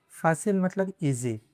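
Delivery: ordinary talk, nothing marked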